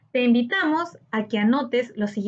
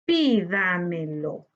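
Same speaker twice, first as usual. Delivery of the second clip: "Pídamelo" slow and lower, in four syllables; 5 semitones lower, slower than usual. Shown as a demonstration. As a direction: measure out each syllable of "Pídamelo". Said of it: "debemos separar por sílaba". ("Pídamelo" lento, sílaba por sílaba)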